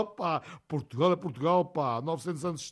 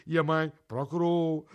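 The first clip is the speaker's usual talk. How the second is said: ordinary talk, nothing marked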